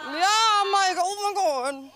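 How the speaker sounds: high, loud embarrassed groan of words; high loud mortified reaction to mom; mortified exclamation. Retold or imitated.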